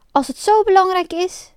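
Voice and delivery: defiant offer. A narrator's reading. Pinocchio offers himself instead of his friend, defiant. Pinocchio biedt zichzelf moedig aan.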